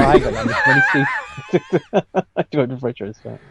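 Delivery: high-pitched, laughing through the words; laughing protest. Real, not acted. Telling Steve he can't afford French restaurants, laughing high.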